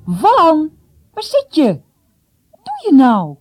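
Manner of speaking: loud, high-pitched call. A narrator's reading. Boy looking for his hidden friend Wan, calling out. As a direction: shout out for someone out of sight.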